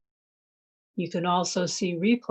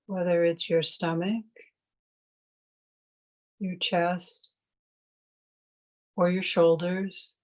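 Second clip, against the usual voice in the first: guided meditation. Real, not acted. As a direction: ease them into noticing the breath. Leads a mindfulness meditation.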